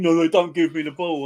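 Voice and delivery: mock whiny complaint; a hint of a sulky footballer moaning. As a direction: imitate a player moaning about the ball. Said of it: "Always moaning".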